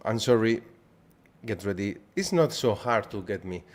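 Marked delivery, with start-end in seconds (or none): none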